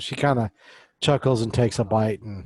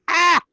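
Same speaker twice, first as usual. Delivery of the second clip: sudden high, loud yelp; startled yelp; 11 dB louder than usual. Acted. Zulia gets snatched up off the floor, startled yelp. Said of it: "she's like".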